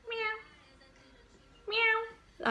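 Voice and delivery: high, small cat meow; imitated cat meow. Shown as a demonstration. Woman secretly meowing at work, imitated cat meow. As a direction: mew like a cat, high-pitched. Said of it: "I meow a lot".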